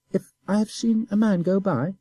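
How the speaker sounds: put-on high, innocent boy's voice; simple child. A narrator's reading. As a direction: play the guileless little boy. Boy echoes the question.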